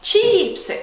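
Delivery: CHIIIP-sy, first syllable high and long; stress on first syllable. Shown as a demonstration. Teacher showing where the stress is, first-syllable stress. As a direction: punch and stretch the start of Chipsy. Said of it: "Where is the stress?".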